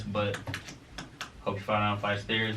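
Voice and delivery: ordinary delivery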